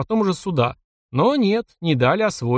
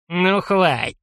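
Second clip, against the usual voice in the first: put-on old man voice; 4 semitones higher, much slower than usual. A narrator's reading. Old man stops beating.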